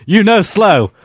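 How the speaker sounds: loud, high and laughing; hammy character voice. Acted. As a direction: overplay the line, cracking up. Acting the script role.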